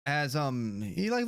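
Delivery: ordinary talk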